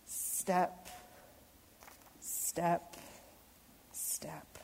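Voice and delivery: whispered step, step, step; soft footsteps. Acted. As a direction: tiptoe the words like creeping footsteps. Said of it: "hearing how I'm reading the story".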